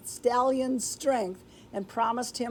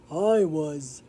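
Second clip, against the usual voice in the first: lower, slow outlaw mumble; mumbled reply; 5 semitones lower than usual. Acted. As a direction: mumble it low like a cowed gang boss. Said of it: "mumbled Gunsmith".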